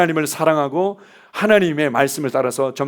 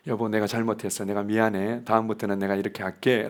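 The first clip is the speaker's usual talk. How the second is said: softer and lower, a sincere sorry; sincere apology; 6 semitones lower, 6 dB quieter than usual. Retold or imitated. Husband going into the office to apologise to his wife, soft and sincere. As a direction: apologise gently to your wife.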